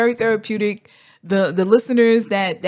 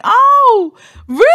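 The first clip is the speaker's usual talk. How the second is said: much higher, bright oh really; delighted surprise; 7 dB louder, slower than usual. Retold or imitated. Answering a fan who loves the podcast, delighted and much higher. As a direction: squeal it out, touched.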